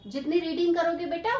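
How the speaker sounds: ordinary talk, nothing marked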